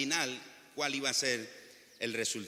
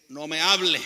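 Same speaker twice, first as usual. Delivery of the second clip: loud burst of acted childish anger; mock a child's angry outburst, loud; 11 dB louder than usual. Preacher playing a frustrated son refusing his father, mock angry. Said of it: "Está enojado".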